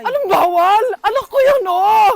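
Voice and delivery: high, loud anguished cry; desperate shout. Acted. Mother shouting at police to reach her dead son. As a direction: force your way to your dead child.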